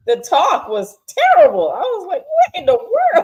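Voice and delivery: laughing, then a very high, loud "what in the world"; stunned mock outrage; shocked. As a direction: squeal in disbelief. Shocked at dinner talk.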